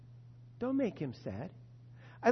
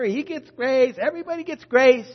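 louder and higher, "grace" punched; emphatic rising shout; 6 semitones higher, 10 dB louder than usual. Real, not acted. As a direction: build to a ringing declaration. Insisting grace for everybody.

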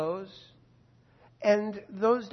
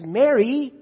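calling her name; 5 semitones higher, 11 dB louder than usual. Acted. Jesus calls Mary by name.

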